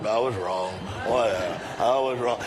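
low, grudging mock mumble; mumbled half-meant apology. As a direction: imitate a dismissive, mumbled admission. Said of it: "The rest of us kinda mumble it".

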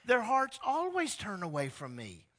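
hammed-up angry God voice. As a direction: let God's anger rise up. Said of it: "I was so angry with them".

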